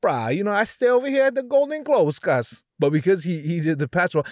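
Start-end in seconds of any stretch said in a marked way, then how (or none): 0.0-2.6 put-on Hawaiian local accent, pitch jumping up. Comic playing Chet as his Hawaiian grandma, Hawaiian accent. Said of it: "if he talked like my grandma and shit, who's from Hawaii".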